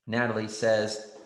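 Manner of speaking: normal talking voice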